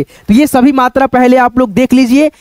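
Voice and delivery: normal talking voice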